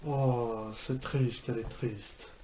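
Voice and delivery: low, soft sympathetic sadness; saddened. Real, not acted. Viewer feeling sad for the character on screen, saddened. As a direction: say it low and quiet, sad for her. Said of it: "Moi aussi, je suis triste".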